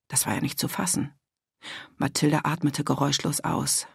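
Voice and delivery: plain reading voice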